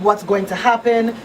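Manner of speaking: usual speaking voice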